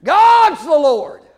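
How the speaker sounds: normal talking voice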